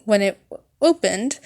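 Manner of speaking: ordinary delivery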